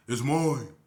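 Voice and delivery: like Thor. Retold or imitated. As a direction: thunder it out like Thor himself. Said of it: "like he Thor or somebody".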